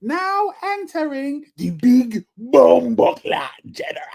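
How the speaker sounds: slow booming entrance call; ring announcer voice. Shown as a demonstration. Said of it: "you gotta, like, lose your voice".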